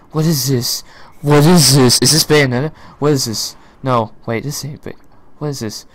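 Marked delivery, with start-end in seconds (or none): none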